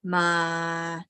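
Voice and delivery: "ma" held low and level; low, steady "ma"; low pitch. Shown as a demonstration. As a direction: sustain "ma" on a low note. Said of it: "low pitch".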